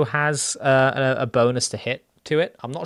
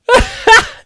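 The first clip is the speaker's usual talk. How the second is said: high loud giggle; amazed laugh; 14 dB louder than usual. Real, not acted. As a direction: laugh in disbelief.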